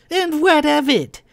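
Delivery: high, loud mimicry of a Howe accent; mocking Howe accent. A narrator's reading. Kai mocking Verlin's words, exaggerated Howe accent. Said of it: "Kai said in mocking Verlin's Howe accent".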